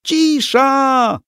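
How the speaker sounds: very high, loud bleating shriek; goat-voiced yell. A narrator's reading. Hans silencing the rioting audience with a goat-like yell. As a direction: screech it to silence the hall. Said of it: "wrzasnął swoim kozim głosem".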